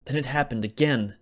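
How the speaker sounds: plain reading voice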